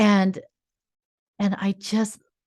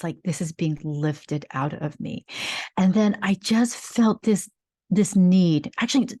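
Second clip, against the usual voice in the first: ordinary talk, nothing marked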